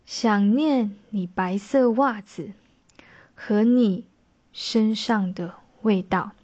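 slow, weighted reading, leaning on 和你; spoken with stress. Shown as a demonstration. Teacher reads lyric with chosen stresses, slow and weighted. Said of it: "这是一种轻重音的口气".

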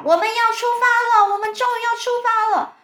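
very high, loud hollering; excited shouting. A narrator's reading. Someone announces the departure in the morning, shouting. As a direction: cry it out to wake the whole room. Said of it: "不知道是谁在大叫".

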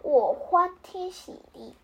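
ordinary delivery

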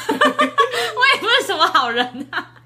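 high laughing protest, drawn out. Real, not acted. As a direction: laugh through the words, slowly. Laughing self-deprecation. (笑着慢慢说)

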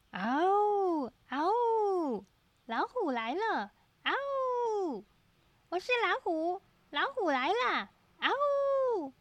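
high, soft would-be tiger roar; tiny squeaky trying to roar. A narrator's reading. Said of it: "不停地发出可怕的吼叫声".

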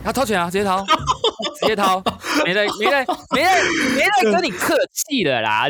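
high-pitched laughing retelling; laughing while telling the co-host the boss paid. Real, not acted.

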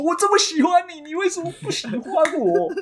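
mock crying plea. S0 playing the rejected suitor confessing to the girl, mock sob. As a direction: beg her like a spurned suitor, fake tears. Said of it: "这边哭说".

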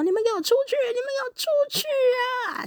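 high, tearful scream to get out; crying shout. Retold or imitated. Daughter screaming at her parents to leave her room, crying shout. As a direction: scream it through tears, losing control. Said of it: "我就大叫了一声这样".

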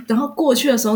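normal talking voice

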